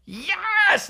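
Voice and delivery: high-pitched squealed yes; exultant outburst. Acted. Comic hyping himself up on his podcast, exultant squeal. Hyped rant about his happiness.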